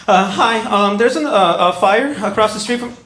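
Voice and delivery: high, hesitant, awkward voice; nervous high caller. Retold or imitated. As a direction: sound timid and unsure on the phone. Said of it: "She got mad at me".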